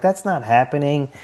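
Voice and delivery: quoting the nurse wife lightly; a hint of a skeptical nurse; mildly voiced wife's denial. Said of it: "She's like".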